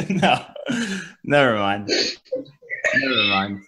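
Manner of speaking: normal talking voice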